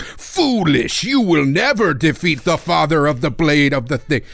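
lightly voiced stern old samurai; a hint of a solemn samurai master. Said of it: "this old samurai guy being like".

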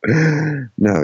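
usual speaking voice

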